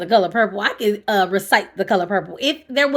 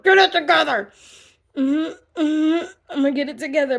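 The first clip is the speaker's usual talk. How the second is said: higher and louder, choking back tears; tearful self-talk; 6 semitones higher, 4 dB louder than usual. Retold or imitated. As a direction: fight the tears while ordering yourself to stop. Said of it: "I'm telling myself".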